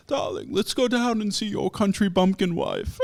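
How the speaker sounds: sneering mid-Atlantic grandmother calling her son darling; mock transatlantic accent. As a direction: play the snooty grandma in a fake transatlantic voice. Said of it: "transatlantic accent, like Aubrey Hepburn".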